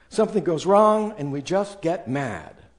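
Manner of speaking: ordinary talk, nothing marked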